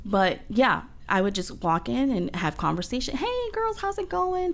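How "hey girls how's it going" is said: bright, much higher sing-song hello; cheery greeting. Retold or imitated. Patient greeting the nurses on arrival, chirpy and high. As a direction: breeze in chirping hello to everyone.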